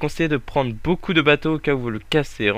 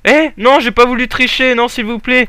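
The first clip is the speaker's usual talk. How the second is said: high, loud pleading protest; panicked pleading; 11 semitones higher, 7 dB louder than usual. Real, not acted. Gamer begging viewers to believe he did not cheat. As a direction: beg not to be seen as a cheater.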